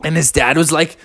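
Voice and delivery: plain reading voice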